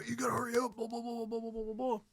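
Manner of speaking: high, softened mock MC patter; strip club DJ. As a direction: imitate a hurrying emcee from a distance. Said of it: "strip club D J guy that was M C'ing it".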